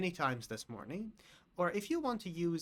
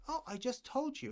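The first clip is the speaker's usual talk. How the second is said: high-pitched, mildly exasperated reminder; mild exasperation; 10 semitones higher than usual. Acted. Teacher answering a bad question, mildly exasperated. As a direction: act a little put out at being asked.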